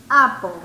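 "Apple" hit loudly on its first vowel, pitch falling; stressed opening vowel. Shown as a demonstration. Teacher shows students the first sound, loud stressed "Apple". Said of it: "¿Cuál es el sonido de la primera?".

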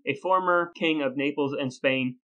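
usual speaking voice

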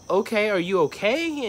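high, drawn-out uneasy question; retold concerned check. Worker asking an abandoned old lady, concerned high voice.

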